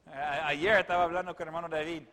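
usual speaking voice

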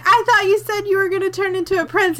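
high-pitched mock accusation; indignant. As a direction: imitate a squabbling complaint. Acted squabble between the frogs.

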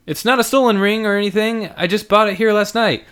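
put-on eager young guy pleading; young man voice. Andrew voiced in story.

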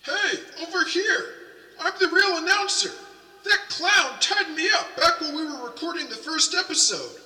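high, urgent calls; calling for help. Acted. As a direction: shout across the room for help. Tied-up announcer calls for help.

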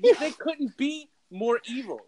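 high, slow and drawn out; slow dramatic emphasis. Acted. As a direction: lean hard into evil. Mock ominous emphasis on villains.

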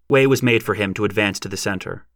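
plain reading voice

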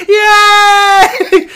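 huge high shouted yay; imitating bus passengers cheering the opened gate; crowd cheer. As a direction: cheer like a whole busload celebrating. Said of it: "everybody on the bus going".

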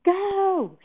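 high, loud shouted order. A narrator's reading. Director to the slow set director, shouting. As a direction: yell it right in his face. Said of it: "shouted Davenport in his face".

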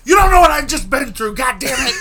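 high, loud exasperated outburst; outraged yelling. Retold or imitated. Imitating his friend's retort on the phone, outraged shouting. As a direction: explode as the aggrieved friend.

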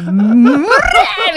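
loud, slow, drawn-out mock howl; imitating a child's angry scream. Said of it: "どういう叫び声なん".

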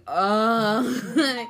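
loud, high startled uh; stunned hesitation. Retold or imitated. Goth girl hearing he plays football, loud startled uh. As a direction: caught off guard by the news.

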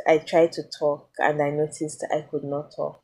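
ordinary delivery

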